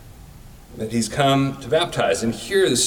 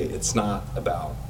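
soft; 6 semitones lower, 7 dB quieter than usual. Shown as a demonstration. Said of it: "when you can say".

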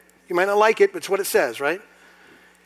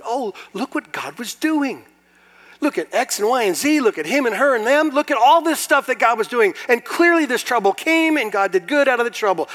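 mock joyful realization; 9 semitones higher, 8 dB louder than usual. Voicing a believer's conclusion.

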